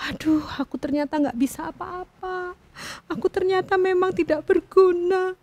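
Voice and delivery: soft crying lament; tearful despair. A narrator's reading. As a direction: whimper out the self-pity. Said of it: "sambil menangis".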